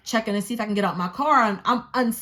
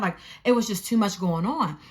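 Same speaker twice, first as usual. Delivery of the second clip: usual speaking voice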